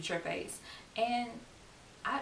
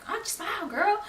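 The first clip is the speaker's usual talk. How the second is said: high, pushy imitation, louder on the last word; hammed-up high-pitched nagging; mock catcaller; 13 semitones higher, 7 dB louder than usual. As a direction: chirp it high at a passing stranger. Mimics stranger on the street.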